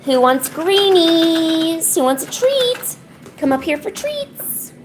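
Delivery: high sing-song, stretched out; treat-time call. Real, not acted. Owner calls the cats up for treats, high sing-song. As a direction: lure the pets with long high vowels.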